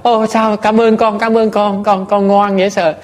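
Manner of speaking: put-on high, beaming thanks to a sweet child; a grinning old lady gushing thanks; delighted thanks. Roberta thanking the boy, beaming with delight. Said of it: "cười tít mắt".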